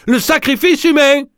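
encore plus aigu, sacrifice martelé; chaviré. Acted. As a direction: hurle sacrifice en extase. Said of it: "chaviré". (Pierre crie, chaviré, très aigu)

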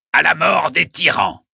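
high, loud rallying toast; proposing a toast. A narrator's reading. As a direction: cry it out with fervour. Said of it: "en train de porter un toast".

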